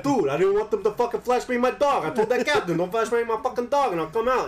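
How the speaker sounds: high, loud, hammed-up Boston accent; thick Boston accent. Retelling his buddy's standoff with police, in a thick Boston accent. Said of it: "really thick, like, Boston accent".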